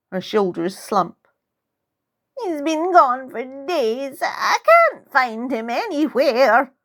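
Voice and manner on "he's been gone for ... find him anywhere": put-on slow, high, forlorn old lady; sad old woman. Sad old woman, cat missing.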